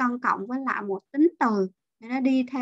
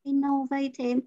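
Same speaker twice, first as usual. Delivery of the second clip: innovative as in-no-VAY-tive; innovative with stress wrongly on the third syllable. Retold or imitated. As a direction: echo the mistake, innovative with a full VAY. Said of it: "âm ba đó".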